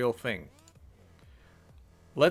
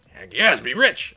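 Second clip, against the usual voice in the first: excited shout; 2 semitones lower, 9 dB louder, slower than usual. Real, not acted. Gleeful game choice exclamation.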